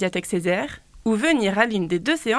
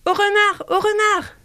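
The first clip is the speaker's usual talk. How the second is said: very high alarm shout; mother crow's alarm cry; 10 semitones higher than usual. Acted. Mother crow warns of the fox with a very high shout. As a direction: cry out the warning like a panicked mother bird. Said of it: "Et qu'est-ce que la maman de Gilles crie?".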